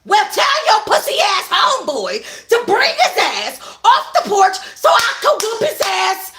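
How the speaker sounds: high, loud laughing taunt; taunting yell. Acted. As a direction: jeer and laugh at him. Akima taunting from yard.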